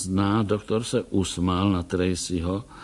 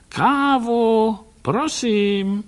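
calling out; 12 semitones higher, much slower than usual. A narrator's reading. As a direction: call so they hear it outside. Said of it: "Hlasitě pak zavolal".

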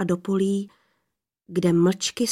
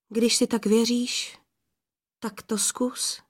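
higher voice, slowing and softening into wistfulness; sadly languid, pitying; 4 semitones higher than usual. A narrator's reading. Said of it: "ten smutně vláčný, lítostivý hlas". (smutně vláčný, lítostivý hlas)

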